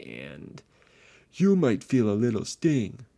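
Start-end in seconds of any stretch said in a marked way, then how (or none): 1.3-3.1 loud, hulking brute growl; monster doctor voice. Acted. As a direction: boom it like a giant creature. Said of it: "the creature said".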